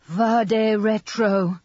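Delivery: high, long-drawn cry of a nun; slow, high-pitched chanting shout; shouted exorcism. A narrator's reading. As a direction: cry it out slowly to ward off evil. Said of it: "she shouted".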